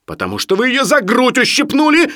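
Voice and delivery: high, loud, angry retort; shouted accusation. A narrator's reading. Doctor accuses Sharikov of pinching a lady, shouting. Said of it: "закричал Барменталь".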